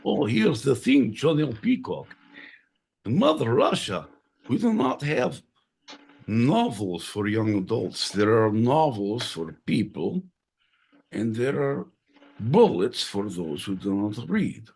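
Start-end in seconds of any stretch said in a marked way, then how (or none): 3.0-14.7 a lightly put-on Russian villain accent; mock Russian accent. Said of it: "we're gonna do accents".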